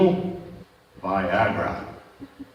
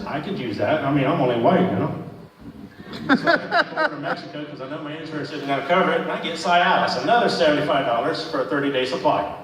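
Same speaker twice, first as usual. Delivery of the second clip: ordinary delivery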